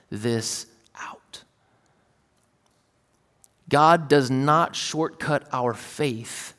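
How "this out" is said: whisper. Real, not acted. Sermon point, trailing.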